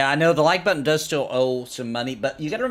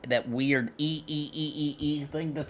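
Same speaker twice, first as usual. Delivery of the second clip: dolphin squeak; 4 semitones higher, 5 dB quieter than usual. Shown as a demonstration. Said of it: "I can't do that weird".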